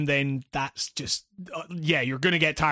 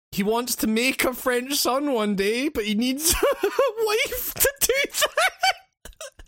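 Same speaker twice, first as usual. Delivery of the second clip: laughing. Real, not acted. Laughing while narrating plot.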